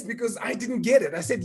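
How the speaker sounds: ordinary talk, nothing marked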